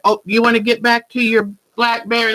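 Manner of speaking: normal talking voice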